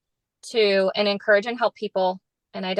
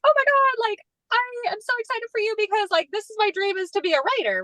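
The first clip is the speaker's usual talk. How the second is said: very high, squealing delight; high-pitched squeal of excitement; gushing excitement; 12 semitones higher than usual. Retold or imitated. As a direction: gush with thrilled joy for a friend. Gushing excitement for her friend.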